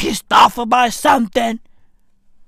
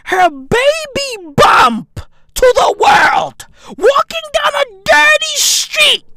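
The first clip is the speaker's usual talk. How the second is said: exasperated rant; 13 semitones higher, 5 dB louder than usual. Real, not acted. Comedian ranting about a supposedly genius photo, exasperated shout. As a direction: hammer each phrase in high-pitched outrage.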